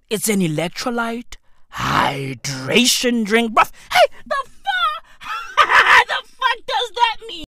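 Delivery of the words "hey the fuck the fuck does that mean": high squeaky outraged disbelief; shrieking the fuck, stunned; shocked disbelief. Real, not acted. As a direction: pitch way up in total disbelief.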